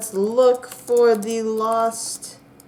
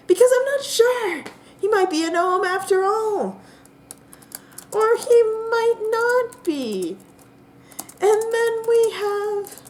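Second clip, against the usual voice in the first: much higher, squeaky and slow; Bobby's nervous voice; 12 semitones higher than usual. Acted. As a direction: hedge every sentence in a strained falsetto.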